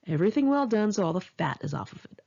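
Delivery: ordinary delivery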